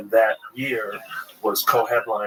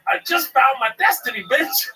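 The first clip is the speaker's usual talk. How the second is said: fired-up insistence; 13 semitones higher than usual. Retold or imitated. Boy telling his brother his destiny, hammed-up shout. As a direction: yell it like you've found your calling. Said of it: "I was like".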